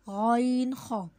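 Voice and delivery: गॉइन ख slow, rasped at the top of the throat; throat letter demo. Shown as a demonstration. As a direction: scrape गॉइन ख slowly from the front of the throat. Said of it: "ये हलक से अदा होते हैं".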